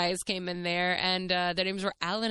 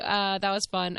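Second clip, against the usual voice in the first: ordinary talk, nothing marked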